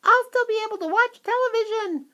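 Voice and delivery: very high, defiant squeak; smug little voice; tiny squeak. A narrator's reading. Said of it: "squeaked the tiny voice".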